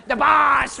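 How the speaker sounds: loud mock griping; hammed-up whine about the job; whining complaint. Coworker griping about his job and the boss, loud mock whine. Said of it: "whining and complaining".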